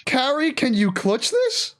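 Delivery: high, drawn-out urgent question; high-pitched plea as the fight hangs; tense excitement. Real, not acted. Caster wills a hero to clutch it, excited. Live match commentary at climax.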